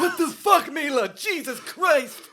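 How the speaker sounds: high, loud strained mock moan. Villain shot by Mila, strained hammed-up moan. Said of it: "It's such a strained moan".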